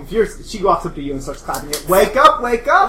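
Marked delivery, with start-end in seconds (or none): 1.9-2.9 high, loud sing-song rousing; perky camper rousing Heather with claps; peppy wake-up. Acted. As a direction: clap them awake with bright energy. Girl claps, wakes them.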